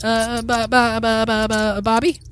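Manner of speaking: high squeaky stutter on Bobby; a nervous rat tripping over its name; stammering rat. Acted.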